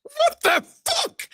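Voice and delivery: high, slow disbelieving cry; shocked. Real, not acted. Shocked by the mock song.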